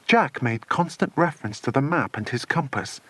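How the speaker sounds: ordinary delivery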